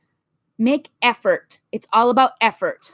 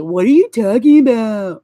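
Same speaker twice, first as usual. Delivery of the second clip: dismissive quote; 2 semitones lower, 5 dB louder than usual. Retold or imitated. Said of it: "stop looking at me and say".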